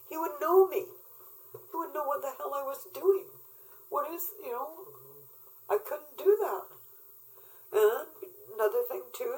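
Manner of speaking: fighting back tears while telling it; real crying rising through the words; tearful. Real, not acted. Tearful memory of losing son.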